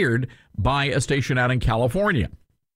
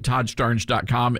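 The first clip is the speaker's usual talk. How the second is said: usual speaking voice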